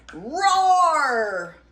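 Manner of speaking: loud, long sustained roar; troll roar. Acted. Troll startling Big Gruff, long loud roar. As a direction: let out a big storybook roar. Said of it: "roared the troll".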